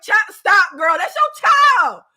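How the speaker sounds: high, loud outcry; exasperated scolding. Acted. Viewer telling off a mother on screen, exasperated shout. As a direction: shout it in disbelief, dragging the last words.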